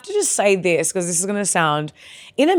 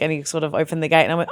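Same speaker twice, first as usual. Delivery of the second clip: ordinary delivery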